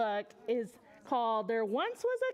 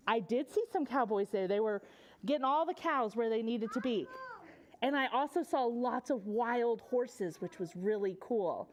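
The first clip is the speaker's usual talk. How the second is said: plain reading voice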